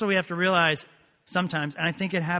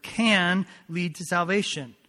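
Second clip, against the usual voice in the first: "can" stretched and louder; stress on CAN; 2 semitones higher, 4 dB louder, slower than usual. Shown as a demonstration. Preacher resuming his point to the congregation, strong stress on "can". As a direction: punch "can", then finish the phrase slowly. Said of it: "I stress that word".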